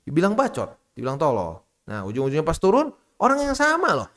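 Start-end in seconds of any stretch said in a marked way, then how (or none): none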